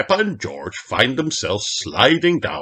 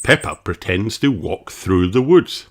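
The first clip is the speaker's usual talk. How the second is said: ordinary delivery, nothing marked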